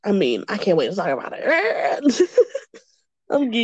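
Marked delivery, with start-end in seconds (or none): 1.5-2.6 laugh turning to cry. Real, not acted. Laughing into crying fit.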